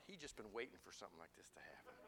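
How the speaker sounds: mocking inner thought. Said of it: "and I thought".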